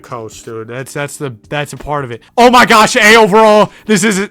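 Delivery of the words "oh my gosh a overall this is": loud, high excited yell; thrilled gamer shouting at his rating. Real, not acted. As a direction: yell with joy at the grade. Excited over an A team.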